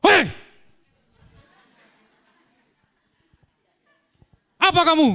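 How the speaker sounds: very high, loud barked challenge; fierce shout. Retold or imitated. Cornered teen yelling back at the angry mob, fierce shout. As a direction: bellow it to freeze the crowd. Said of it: "Saya berteriak".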